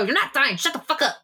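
in character as Remy. Acted. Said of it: "I go".

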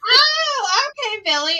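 high, loud "oh", then humoring; startled humoring. Retold or imitated. Teacher humoring pupil's marriage plan, startled. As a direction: squeal in mock surprise, then play along.

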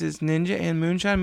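normal talking voice

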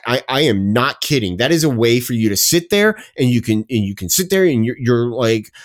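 ordinary delivery

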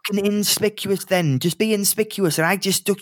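exasperated, talking down to fools; exasperated barman. Acted. Barman orders party.

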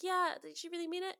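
high-pitched mock questioning; Calamity impression. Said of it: "so she's like".

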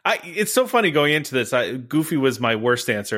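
ordinary delivery

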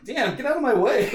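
high, loud annoyed outburst; annoyed grimace voice. Retold or imitated. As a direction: blurt it out, irritated. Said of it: "making, like".

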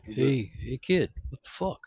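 lightly voiced grumbling star; soft, offhand movie-star gripe; Harrison Ford. Quoting the actor's complaint after the premiere, light impression. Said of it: "most amazing Harrison Ford impersonation".

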